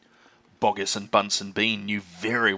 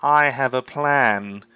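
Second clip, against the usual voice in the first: very slow, louder sly farmer voice; crafty Bean; 6 dB louder, much slower than usual. Acted. Bean telling the others his plan, slow and sly. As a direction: savour the secret. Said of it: "he said".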